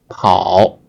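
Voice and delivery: 跑 dipping low; third tone. Shown as a demonstration. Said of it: "is the third tone originally".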